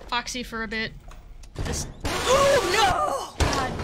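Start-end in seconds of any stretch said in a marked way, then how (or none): 1.5-3.7 high, loud cry of fright; real panic shout; startled yell. Real, not acted. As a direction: yelp as the jump scare hits. Jump scare, startled yell.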